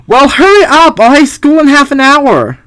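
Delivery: put-on bossy young girl; impatient daughter. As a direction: hurry him along. Voicing Ari.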